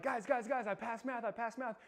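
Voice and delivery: high, loud waking-up call; waking sleeping roommates, thrilled; excited announcement. Retold or imitated. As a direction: burst in shouting the news.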